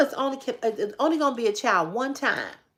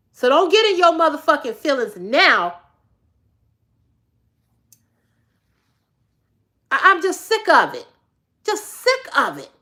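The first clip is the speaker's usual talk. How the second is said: louder, fed-up scolding; angry rant; 4 semitones higher, 5 dB louder than usual. Real, not acted. Woman scolding a persistent commenter, angry and loud. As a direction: let him have it, you're sick of it.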